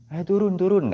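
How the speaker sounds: a hint of a doctor pleading; doctor coaxing. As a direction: gently urge the patient down. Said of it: "katanya itu".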